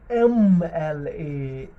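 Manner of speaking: एम एल ए drawn out slowly, holding the 'em' sound; stretched sounding-out. Shown as a demonstration. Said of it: "ज़रा आवाज़ निकालो इसकी". (खींचकर धीरे-धीरे एम एल ए)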